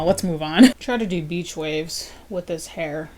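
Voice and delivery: ordinary talk, nothing marked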